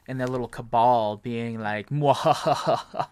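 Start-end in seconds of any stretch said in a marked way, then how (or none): 1.9-3.1 slow hammed-up evil laugh; evil villain cackle. Voicing villains in little cutscenes.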